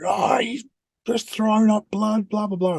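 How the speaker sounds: high, frantic imitation of his panicking wife; mock panic. Wife on the phone to paramedics, mock panicked and high. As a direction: ham up her panic on the phone. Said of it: "she's freaking out in the back".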